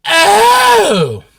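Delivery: loud, very high "Oh!"; realization. Retold or imitated. Remembering he sold the comics, a loud high "Oh!". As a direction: hit the moment it clicks.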